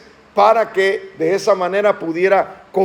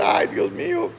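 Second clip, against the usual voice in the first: sighing parent; 9 semitones higher, 5 dB quieter than usual. Retold or imitated. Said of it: "uno dice".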